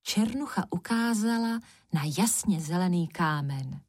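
plain reading voice

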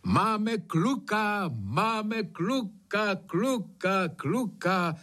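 high, drawn-out call fading into echoes; joyful cry. A narrator's reading. Kuba announces his son from hill to hill, joyful echoing cry. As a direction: call it slowly across the hills, repeats echoing. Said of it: "neprobudil křik: ... To ozvěna roznášela Kubovu radost".

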